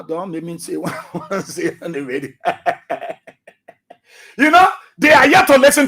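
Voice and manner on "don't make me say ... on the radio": low, soft, through a chuckle; laughing. Real, not acted. Host warning a rival on the radio, laughing. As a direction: hold back while laughing. Laughing while holding back on air.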